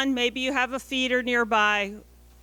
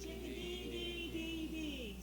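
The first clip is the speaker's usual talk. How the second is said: chickadee call; 4 semitones higher, 16 dB quieter, slower than usual. Shown as a demonstration. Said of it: "tell me what the chickadee says".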